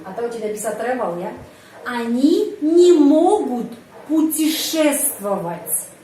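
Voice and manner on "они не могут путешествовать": slow, louder, stress on не; slow model sentence. Shown as a demonstration. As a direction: read each word out slowly for the class.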